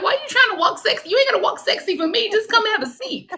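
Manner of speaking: high, playful sassy scolding; teasing scold. Retold or imitated. Psychic teasing a spirit walking sexy, high sassy scold. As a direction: tease her for strutting. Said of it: "I'm like".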